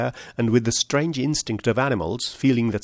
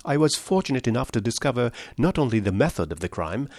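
plain reading voice

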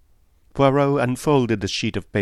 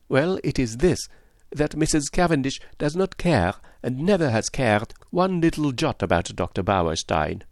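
put-on accented detective, high and emphatic; lightly voiced Poirot; 5 semitones higher than usual. Poirot insisting she never cared, detective voice. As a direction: pronounce the verdict as a confident detective.

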